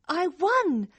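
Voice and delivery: high, delighted realisation; growing joy. Acted. Girl starts believing she won, growing joy. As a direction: let the good news sink in happily.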